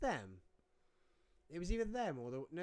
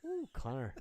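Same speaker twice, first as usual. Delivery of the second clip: imitating Irish accent. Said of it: "He said".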